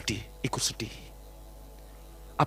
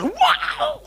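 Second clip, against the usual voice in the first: long, high drawn-out "wow"; imitated wild yell. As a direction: mimic someone waking up and lashing out. Imitates son thrashing on waking.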